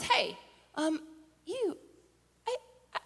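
halting, then point the finger; girl recognizing someone. Acted. Acts girl recognizing Peter by fire.

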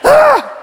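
high, loud mock growl of rage; hammed-up angry yell; angry roar. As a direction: explode in fake anger.

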